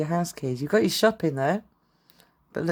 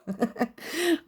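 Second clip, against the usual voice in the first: high giggle; 11 semitones higher than usual. Real, not acted. Laughing at own joke.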